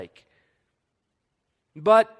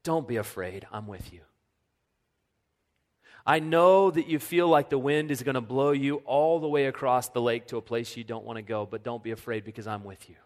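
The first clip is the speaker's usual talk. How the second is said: tender reassurance; 4 semitones lower than usual. Acted. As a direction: hush them like a frightened child. Pastor voices God reassuring.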